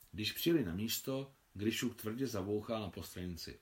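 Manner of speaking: plain reading voice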